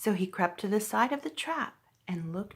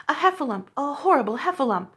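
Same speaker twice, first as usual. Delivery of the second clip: higher, louder, fast frightened cry; terrified cry; 5 semitones higher, 5 dB louder, faster than usual. A narrator's reading. Piglet runs from the trap, terrified and loud. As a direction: blurt it in terror. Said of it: "cried Piglet".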